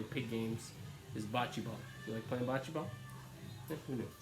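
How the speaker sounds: ordinary delivery, nothing marked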